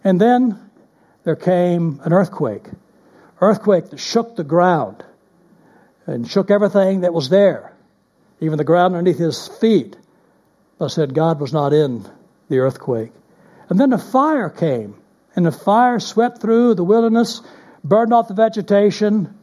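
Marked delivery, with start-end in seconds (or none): none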